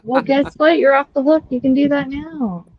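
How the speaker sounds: cheeky sing-song jab; teasing. Real, not acted. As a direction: tease him for wanting a nap. Teasing a co-host.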